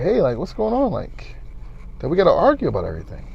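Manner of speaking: soft, non-confrontational questioning; quiet sit-down talk. Retold or imitated. Asking a girlfriend what's going on, softened voice. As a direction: ask it gently, keeping the volume down.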